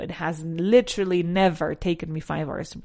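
"never" leaned on hard, "literally" set just before it; emphatic "never", as a firm denial; stress on never. Shown as a demonstration. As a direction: punch "never" in "It has literally never taken me five hours". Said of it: "That's how I would put the emphasis".

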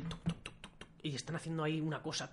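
soft unvoiced tongue taps; imitated tapping. Shown as a demonstration. Describing someone carving wood, soft imitated taps. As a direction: make little tapping noises, TUC TUC.

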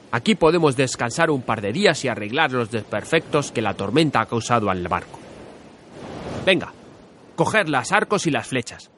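upbeat captain rallying the crew; rally the men, pleased and lively; cheerful Ulysses. Acted. Ulises cheers his men. (capitán alegre y animado)

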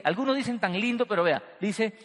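ordinary talk

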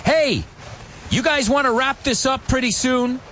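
high mock impatient call; high annoyed quoted voice; impatient radio guy. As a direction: hurry them up over the radio. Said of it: "And this guy says".

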